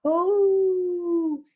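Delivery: high, long howl; wolf howl. A narrator's reading. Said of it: "howled again".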